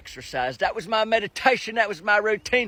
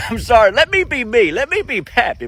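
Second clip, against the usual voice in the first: ordinary talk, nothing marked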